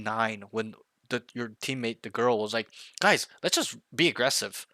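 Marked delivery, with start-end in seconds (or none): 3.0-4.7 higher, louder mock rallying call; teammate's urging. Girl teammate to her team, imitated urging.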